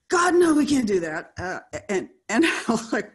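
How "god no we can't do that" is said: loud, high, half-laughing mock horror; mock horrified refusal. Imitating executives rejecting a diverse character, mock horrified. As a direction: play scandalised, much louder and higher.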